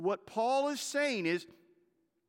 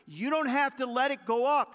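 forceful preaching; 6 semitones higher, 7 dB louder than usual. Acted. Preacher's loud first take.

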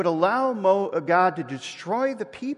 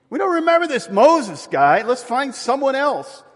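higher, louder mock dismissive people; dismissive crowd; 9 semitones higher, 7 dB louder than usual. The people dismissing Moses, higher and louder mock scorn. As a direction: toss Moses aside as the fickle people. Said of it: "the people have moved past Moses".

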